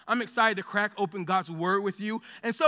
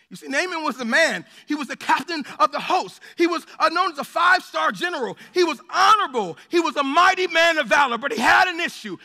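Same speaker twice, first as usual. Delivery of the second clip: louder, rising preacher's cadence; building preaching; 4 semitones higher, 6 dB louder than usual. Acted. Pastor starting to preach Naaman's story, building in volume. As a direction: build the case with growing force.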